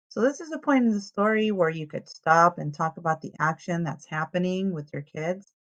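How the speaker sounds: plain reading voice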